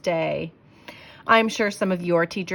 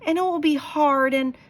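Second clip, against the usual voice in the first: higher, louder pleading whine; whiny child; 9 semitones higher, 5 dB louder than usual. Acted. Sarah explaining her fears to dad, whiny. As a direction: plead like an unhappy kid.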